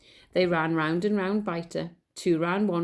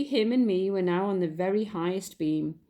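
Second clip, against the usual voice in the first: usual speaking voice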